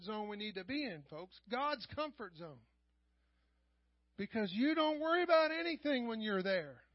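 loud, high preaching at full force; fiery preaching. Acted. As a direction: preach it out to the congregation.

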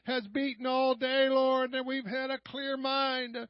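impassioned praise, swelling on key words; fervent prayer. Real, not acted. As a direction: cry out thanks to God at full force. Leads fervent prayer of thanks.

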